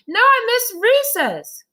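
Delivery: high, loud wail of dismay; disappointed child Joe. Acted. Boy realises he missed recess, high dismayed wail.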